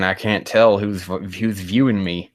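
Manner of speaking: ordinary talk, nothing marked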